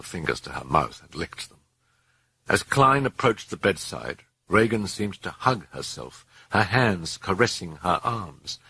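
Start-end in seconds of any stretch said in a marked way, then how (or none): none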